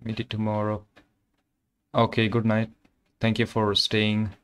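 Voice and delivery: ordinary talk, nothing marked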